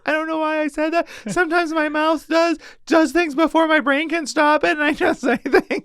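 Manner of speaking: imitating a tearful kid, very high pitched; high mock-crying little voice; bawling child. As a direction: wail it like a remorseful small child. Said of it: "bawled for, like, the rest".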